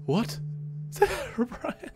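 ordinary talk, nothing marked

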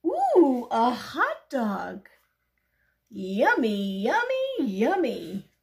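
loud, drawn-out delight with swooping pitch; excited. Acted. Pigeon gloating over a hot dog it found, excited. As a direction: relish it loudly, like a delighted finder. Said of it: "He's excited to have found".

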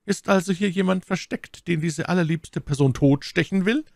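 mock her with open contempt; scornful mockery. A narrator's reading. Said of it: "in einem höhnischen und verächtlichen Ton". (Lord Winter verspottet My Lady höhnisch und verächtlich)

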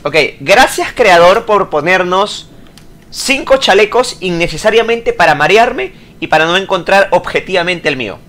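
normal talking voice

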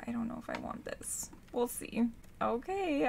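normal talking voice

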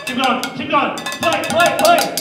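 normal talking voice

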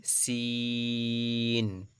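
সিন with thin hiss and very long "ee"; light front-tongue s, then hold the vowel of সিন. Shown as a demonstration. Teacher models small seen, সিন thin and drawn out. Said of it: "পাতলা হবে".

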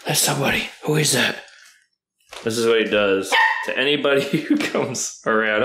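usual speaking voice

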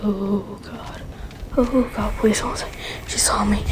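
hushed terrified plea; whispering while hiding. Real, not acted. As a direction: breathe it out so she can't hear. Hiding in bushes from her.

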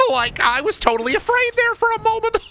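very high cartoon squeal, giggling; Shaggy. Shaggy admits he was scared, squealing giggle. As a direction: squeal with goofy nervous relief. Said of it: "Shaggy, you fucking idiot".

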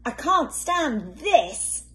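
slow, fed-up complaint; exasperated, slow. A narrator's reading. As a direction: drag it out, fed up. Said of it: "said Mister Bear".

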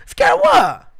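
very high, loud squeal; mock incredulous. Answering scared coworker, shrill incredulous. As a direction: shriek it in disbelief. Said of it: "I was like".

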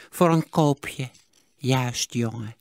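put-on slow stingy old voice; eagerly repeat, drawn out; miser repeating. A narrator's reading. Miser echoes the boy's bargain, slow old voice. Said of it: "herhaalde Sigertsma".

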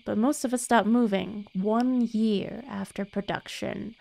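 normal talking voice

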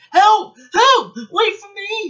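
high, frantic yelling; desperate loud calls; cry for help. A narrator's reading. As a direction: scream for rescue, panicked. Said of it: "he cried".

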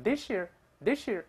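this year run together as "thi-sheer"; quick "this year" with a sh in the middle; linked pronunciation. Shown as a demonstration. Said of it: "变成sh这个声音".

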